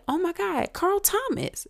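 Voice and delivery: high-pitched re-enacted fan excitement; starstruck gasped greeting. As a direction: squeal it lightly like a fan spotting an idol. Acts out spotting her idol.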